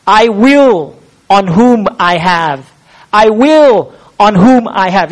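"will" and "have" hit hard, slow and deliberate; slow, leaning on "will" and "have"; stress on the verbs. Shown as a demonstration. Preacher pointing out the verbs to his listeners, stressing "will". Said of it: "the emphasis is on the verbs".

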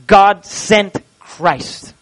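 ordinary delivery

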